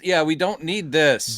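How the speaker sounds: normal talking voice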